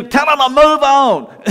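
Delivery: loud, high mock sergeant's order; playful drill-sergeant command; first sergeant. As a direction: bark it like a commander, half laughing. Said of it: "he's talking like a first sergeant".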